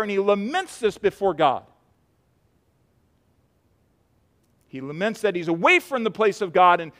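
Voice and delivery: loud, high pulpit voice driving each line; full force preaching. Acted. Preacher pleading with the church about despair, full force. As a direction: preach it out with fire to the congregation.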